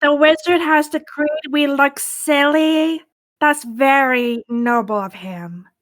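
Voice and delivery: ordinary talk, nothing marked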